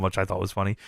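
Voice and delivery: ordinary delivery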